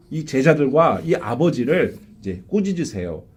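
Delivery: ordinary delivery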